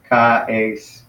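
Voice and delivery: "ka-ace" said as ka, then ace; two separate syllables. Shown as a demonstration. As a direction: keep the vowels of "ka-ace" apart. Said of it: "So it'd be two syllables".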